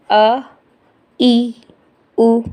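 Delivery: अ, ई, उ said as short single-beat vowels. Shown as a demonstration. Teacher listing short vowels for students, one short beat each. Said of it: "ह्रस्व स्वर".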